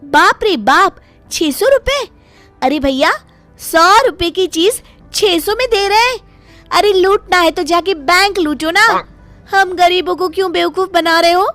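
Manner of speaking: loud, high-pitched indignation; outraged haggling. Acted. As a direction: tell off the seller at full voice. Outraged customer rejects the seller's price.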